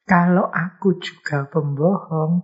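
usual speaking voice

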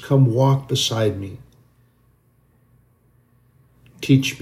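leading a heartfelt closing prayer. Real, not acted. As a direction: pray it aloud to the Father for the listeners. Leads a prayer.

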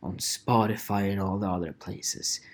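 mock critic whispering; whispered critic. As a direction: hiss it like a gossiping listener. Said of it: "And people go like".

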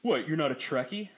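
smug Trekkie. Retold or imitated. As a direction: lord the answer over someone. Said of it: "It's like".

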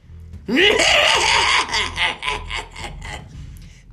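high, loud peal of cackling; witch cackle. Acted. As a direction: cackle with wicked glee. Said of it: "a peal of laughter from Snitch".

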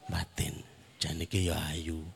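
low, very soft aside; hushed, as if only thinking it; inner thought. Acted. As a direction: murmur it privately to yourself. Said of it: "Mboten dibatin".

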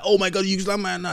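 high, quick outburst at normal volume; high-pitched pretend outcry; mock yell. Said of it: "instead of the grandfather to yell like".

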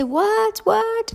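high, confused double take; baffled. Acted. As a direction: squeak out disbelief. Disciples baffled by Jesus.

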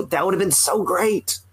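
ordinary talk

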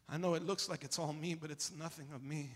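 hushed. Acted. Hushed contrast to boasting.

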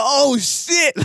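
high startled oh shit; alarmed exclaim. Retold or imitated. Stoned passenger as lights come on. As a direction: react to the crash, high and sudden. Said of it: "So I'm like".

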